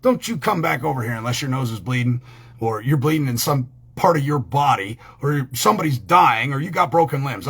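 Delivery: ordinary talk, nothing marked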